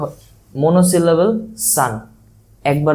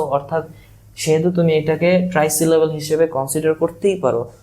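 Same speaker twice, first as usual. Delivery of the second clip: ordinary talk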